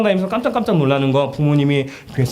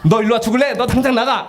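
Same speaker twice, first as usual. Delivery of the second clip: higher, mock-furious threat; mock angry parent; 7 semitones higher than usual. Parent threatening child to leave home, mock anger. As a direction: bark a hammed-up get out.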